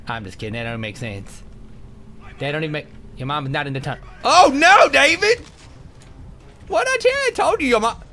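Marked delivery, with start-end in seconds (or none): 4.2-8.0 high, loud alarmed cries; alarmed shouts. Real, not acted. Gamer shouting in alarm at companion David. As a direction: call out to the fallen companion.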